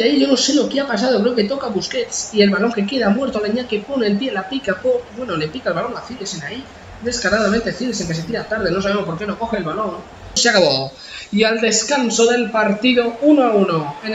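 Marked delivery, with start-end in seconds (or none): none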